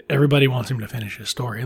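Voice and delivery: ordinary delivery, nothing marked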